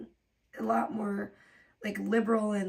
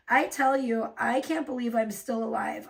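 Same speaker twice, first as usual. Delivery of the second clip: ordinary talk, nothing marked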